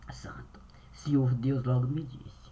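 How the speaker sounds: breathless unvoiced whisper, dropping low; secretive whisper. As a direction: hush it as if sharing a secret. Hushed aside before God speaks.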